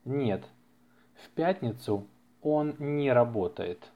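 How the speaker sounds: ordinary delivery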